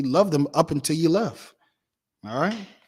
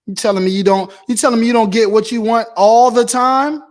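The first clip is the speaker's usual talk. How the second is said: loud, high, disbelieving challenge; incredulous shout; 4 semitones higher, 9 dB louder than usual. Real, not acted. Preacher challenging a selfish spouse, incredulous shout. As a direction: throw the question back at them, loud.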